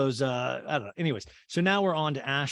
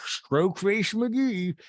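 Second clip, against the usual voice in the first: high, very slow put-on voice; really weird voice; 7 semitones higher, 3 dB louder, much slower than usual. Mocking a guest's odd talk, in a weird voice. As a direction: drag the words out oddly, pitched up. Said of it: "she's using that really weird voice".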